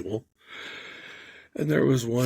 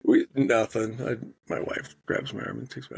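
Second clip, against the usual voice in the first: usual speaking voice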